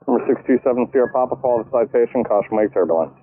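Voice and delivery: ordinary delivery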